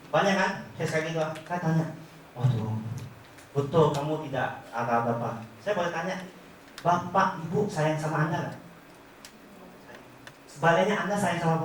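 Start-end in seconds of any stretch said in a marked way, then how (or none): none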